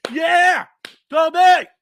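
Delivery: loud, high cheering shout; retold cheer. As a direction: cheer like in the theater. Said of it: "I was like".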